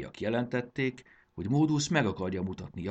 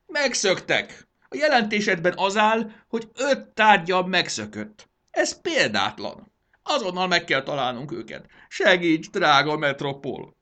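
frantic distress; 11 semitones higher, 9 dB louder than usual. Acted. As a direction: plead in theatrical panic. Tearful ruler begs adviser for help.

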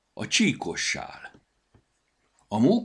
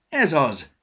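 loud, higher eureka; excited squirrel cry; 8 semitones higher, 9 dB louder than usual. A narrator's reading. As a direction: burst out with sudden delight. Squirrel gets an idea.

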